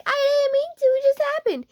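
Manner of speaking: very high frantic squeal; panicked excuse. Acted. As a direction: squeak out a hasty defence.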